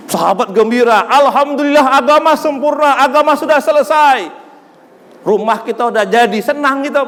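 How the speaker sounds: high, loud dramatic retelling; impassioned storytelling. Acted. Preacher retelling the companions' joy, loud and high. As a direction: paint the companions' joy vividly.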